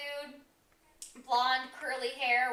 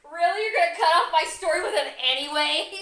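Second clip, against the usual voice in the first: mock indignant; 3 semitones higher, 6 dB louder than usual. Real, not acted. Streamer cut off mid-story.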